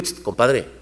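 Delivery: soft, low near whisper; hushed call. Retold or imitated. Man calling his nearby friend, hushed and soft. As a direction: call him softly, he's close now. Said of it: "él con los binoculares lo vio cerca".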